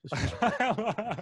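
slow, high ad announcer read; slogan voice. Acted. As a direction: pitch it like a sneaker ad. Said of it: "it'd be a great slogan for sneakers".

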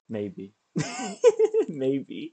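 amused, rising giggly reply; laughing. Real, not acted. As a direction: say it through a laugh. Laughs over own teasing answer.

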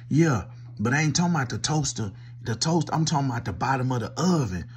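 normal talking voice

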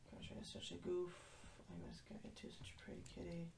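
soft whisper to herself; whispered fond aside about the kitty. Real, not acted. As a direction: breathe it under your breath.

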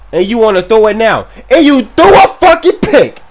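building to a high, full-force scream; angry yelling. Real, not acted. Gamer raging at his quarterback's interception, angry yelling. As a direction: explode at the quarterback.

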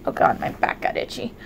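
hushed unvoiced aside about an itchy back; whisper. Real, not acted. Streamer whispering to chat about her back. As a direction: drop to a whisper to tell chat.

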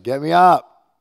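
loud high call from the next room; daughter's demanding yell. Retold or imitated. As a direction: yell it like an impatient kid. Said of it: "She was like".

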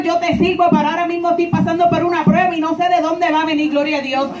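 usual speaking voice

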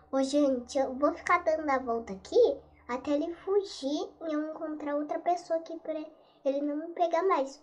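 ordinary delivery

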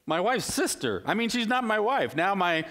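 high, laughing Jacob in disbelief; laughing mock panic. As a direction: play Jacob waking up to the wrong bride, laughing. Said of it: "bad honeymoon story".

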